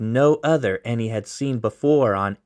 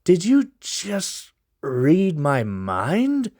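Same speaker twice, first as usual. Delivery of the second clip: defiant sneer; 7 semitones higher, much slower than usual. Acted. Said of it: "defiant sneer as his eyes opened wider with a glare".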